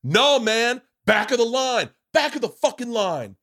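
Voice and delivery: hammed-up doorman turning him away; angry bouncer. Bouncer voiced in retold story.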